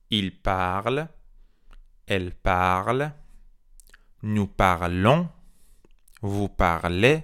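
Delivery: very slow, drawn out, rising ends, long pauses; repeat-after-me drill. Shown as a demonstration. French teacher has learners repeat the conjugation, slow spaced-out drill. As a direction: model each verb form separately for learners. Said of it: "با من تکرار بکنیم".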